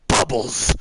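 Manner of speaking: a half-hearted angry bark of Bubbles; mock angry shout. Shown as a demonstration. Streamer saying a chat name in a mock angry voice. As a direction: shout it like you're mad, lightly. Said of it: "This is my angry voice".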